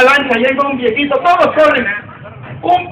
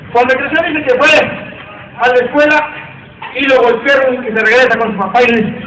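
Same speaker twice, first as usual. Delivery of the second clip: ordinary talk, nothing marked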